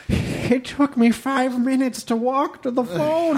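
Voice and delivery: high, slow, frail put-on voice; mock feeble, long-suffering complaint; imitated struggling woman. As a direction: labour through it like someone worn out by walking. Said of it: "how rough of a time she was having".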